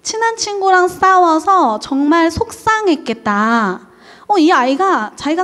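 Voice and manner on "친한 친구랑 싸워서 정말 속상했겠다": slow, higher, gentle empathy; empathetic parent. Shown as a demonstration. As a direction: soothe the child slowly and kindly. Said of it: "반영적 경쟁을 하는 부모는". (공감하는 다정한 말투)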